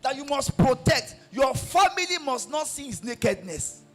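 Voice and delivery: high, loud, shouted sermon; fiery preaching. Acted. Pastor exhorting wives to support husbands, shouted and high.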